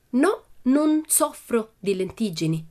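slow, high, word-by-word delivery; emphatic explanation. A narrator's reading. Pippi explaining to the shopkeeper, slow and emphatic. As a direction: spell it out for a slow listener. Pippi spells out her refusal.

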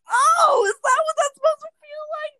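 mock shocked squeal. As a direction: act shocked and clueless. First intimate moment, retold.

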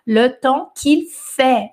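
"Le temps qu'il fait" read very slowly; slow model phrase. Shown as a demonstration. A teacher slowing down the French phrase for beginners. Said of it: "é o verbo faire".

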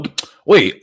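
normal talking voice

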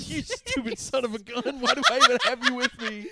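high, loud hammed-up scolding; mock angry rant. Hero berating his sidekick Riley, laughing mock rant.

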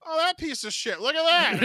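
loud, high jeering outburst; mock derision. Colleague spots actor's bad film, loud jeering. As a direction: crow scornfully across the room.

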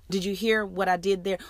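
ordinary talk, nothing marked

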